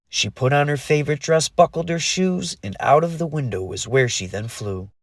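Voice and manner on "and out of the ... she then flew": usual speaking voice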